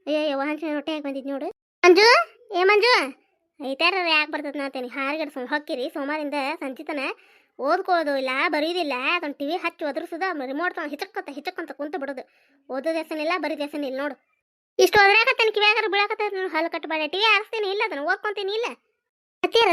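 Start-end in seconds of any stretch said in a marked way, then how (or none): 1.8-3.2 loud call across the house. Acted. Mother calls out to Manju loudly. Mother calling her son. 14.8-16.5 higher, louder exasperated scolding. Acted. Mother scolds her son who won't study, loud and exasperated.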